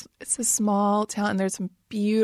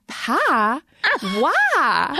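put-on ridiculous Southern accent; 5 semitones higher than usual. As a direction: play up your Southern twang, higher and puzzled. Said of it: "I'll say it ridiculous".

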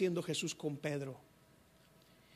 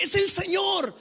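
high, loud burst of recognition; excited cry; 10 semitones higher, 7 dB louder, slower than usual. Acted. John cries out to Peter, high and loud with joy. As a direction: cry out in joyful discovery.

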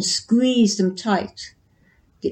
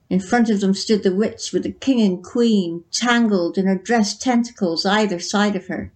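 ordinary delivery

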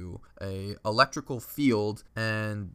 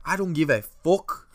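raised, punchy Irish accent imitation; Conor McGregor; 6 semitones higher, 5 dB louder than usual. Irish buddy brushes off friends' warning, mock McGregor accent. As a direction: do a defiant Irish brogue, high and loud. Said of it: "he did it in that accent".

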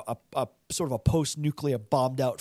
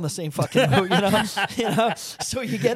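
louder, higher, talking through laughter; laughing; 5 semitones higher, 5 dB louder than usual. Real, not acted. Podcaster cracking up over typecast mafia actors, laughing. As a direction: laugh your way through the words.

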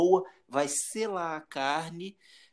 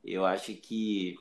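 moved, holding back tears; 6 semitones lower than usual. Real, not acted. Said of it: "você sabe que eu sou chorão pra caralho".

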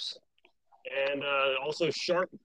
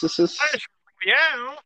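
drawn-out echo; 8 semitones higher, 4 dB louder, much slower than usual. Pretend. Echoing game name playfully.

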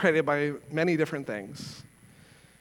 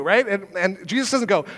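ordinary talk, nothing marked

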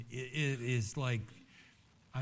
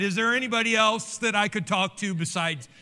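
loud mock plea; 7 semitones higher, 7 dB louder than usual. Voicing the doubting believer.

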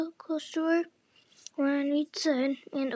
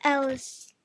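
usual speaking voice

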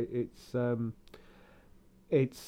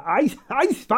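put-on German accent; 10 semitones higher, 8 dB louder than usual. Mimicking the name, high and loud.

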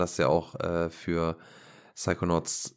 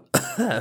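sudden cough after swallowing wrong; choking cough; 5 dB louder than usual. Real, not acted. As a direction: cough as the drink goes down wrong.

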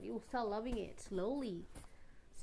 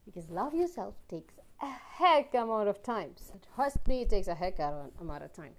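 emphatic; 9 dB louder than usual. Real, not acted. Emphatic advice, trust me.

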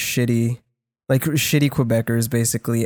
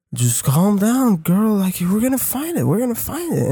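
mock drunk boyfriend; 7 semitones higher than usual. Drunk boyfriend calming his panicking girlfriend, mock slurred. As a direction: soothe her like a drunk boyfriend. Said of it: "Kevin is like".

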